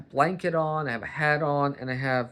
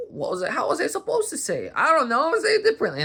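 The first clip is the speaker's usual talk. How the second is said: exasperated; 14 semitones higher, 5 dB louder, faster than usual. Retold or imitated. Exasperated retort to critics.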